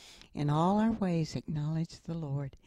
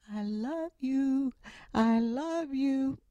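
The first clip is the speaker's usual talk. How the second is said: slow, higher echoing call; echo from across the canyon; 8 semitones higher, slower than usual. Retold or imitated. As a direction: let it ring back like an echo, slowly. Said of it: "from the other side of the mountain".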